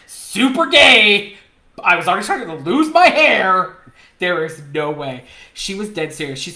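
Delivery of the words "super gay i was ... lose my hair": high, loud, stretched-out disbelief; incredulous outburst. Real, not acted. Comic to co-host on runway scam, incredulous outburst. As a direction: blurt it out high and loud, milking the absurdity. Incredulous rant about being scouted as model.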